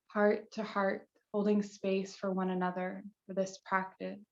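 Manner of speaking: calm, measured guide with long pauses between phrases; unhurried yoga meditation voice; guided meditation. Real, not acted. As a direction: lead the circle gently into stillness, leaving space after each instruction.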